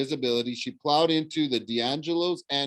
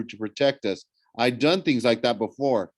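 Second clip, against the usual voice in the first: ordinary delivery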